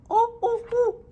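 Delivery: very high, loud monkey calls; monkey hoots. A narrator's reading. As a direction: hoot like a cheeky monkey. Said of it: "the monkeys only stamped both their feet back at him and said".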